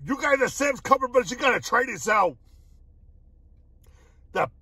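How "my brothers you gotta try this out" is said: ordinary talk, nothing marked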